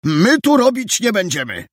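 high, firm refusal; hard and firm. A narrator's reading. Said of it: "twardy i stanowczy".

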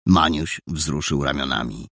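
ordinary delivery, nothing marked

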